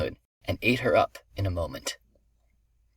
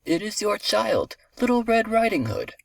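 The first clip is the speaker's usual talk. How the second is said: put-on wolf posing as a child, pitched way up; imitating girl's voice; 12 semitones higher than usual. A narrator's reading. Wolf imitates the girl at grandmother's door, high girlish voice. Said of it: "imitating the girl's voice".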